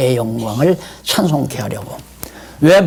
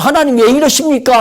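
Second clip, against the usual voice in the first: higher, louder reproach to God; resentful complaint; 9 semitones higher, 3 dB louder than usual. Retold or imitated. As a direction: whine at God from self-pity. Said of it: "하면 지혜가 무뎌져요". (원망하는 말투)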